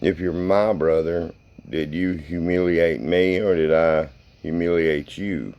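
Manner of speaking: ordinary talk, nothing marked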